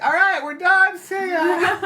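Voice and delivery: high, loud playful shout; hammed-up cheery farewell yell; mock calling out. Guest imitating friends leaving without them, mock calling out.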